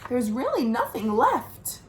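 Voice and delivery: plain reading voice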